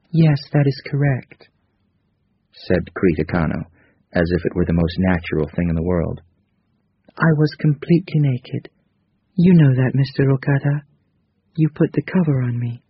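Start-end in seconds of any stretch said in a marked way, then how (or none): 0.1-1.5 higher, gentle female character voice. A narrator's reading. Narrator voices Krita Kano. 7.2-12.8 higher, gentle female character voice. A narrator's reading. Narrator voices Krita Kano.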